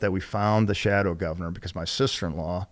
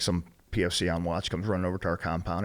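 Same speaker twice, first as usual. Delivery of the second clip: ordinary talk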